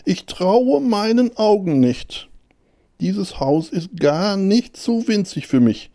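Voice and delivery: high, drawn-out storybook hare voice; amazed little hare. A narrator's reading. Hare finding a tiny house, high amazement. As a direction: marvel in a high voice, stretching the words.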